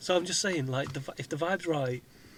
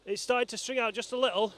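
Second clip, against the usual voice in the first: normal talking voice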